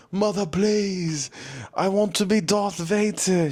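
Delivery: begging. Retold or imitated. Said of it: "begging my mother".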